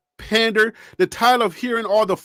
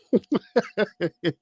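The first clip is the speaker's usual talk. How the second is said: laugh; 5 dB quieter than usual. Real, not acted. Laughing at own apology.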